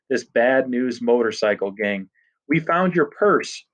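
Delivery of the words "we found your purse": a slightly raised, sudden blurt. A narrator's reading. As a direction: let it burst out nervously. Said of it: "Freak blurts out".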